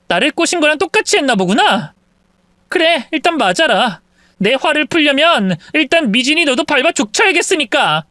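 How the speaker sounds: cold, menacing wife; threatening wife. Acted. Wife threatening to beat the mistress, menacing. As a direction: promise the beating coldly.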